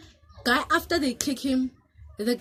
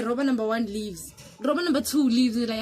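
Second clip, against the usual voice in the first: ordinary delivery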